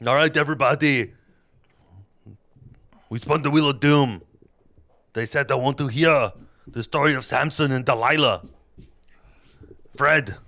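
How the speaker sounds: loud, high comic Bible retelling; boisterous mock-epic narration; hammed-up storytelling. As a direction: tell it like a rowdy campfire tale.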